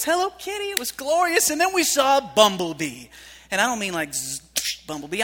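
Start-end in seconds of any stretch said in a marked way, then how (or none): 0.0-3.1 put-on much higher, thrilled toddler voice; high toddler awe; little girl's delight. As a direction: squeal like a three-year-old. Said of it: "Lacey, my three-year-old, is".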